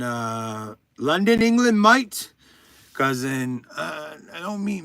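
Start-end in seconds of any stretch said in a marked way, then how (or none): 1.0-2.3 mock British accent. Clarifying what English means, mock British accent. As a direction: put on a London "mate" in British. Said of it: "Or, like, English as in".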